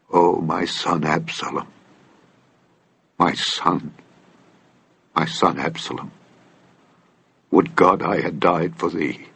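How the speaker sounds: low, hushed, grieving lament. A narrator's reading. King weeping for his son Absalom, low hushed lament. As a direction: mourn quietly for a lost son. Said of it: "and wept".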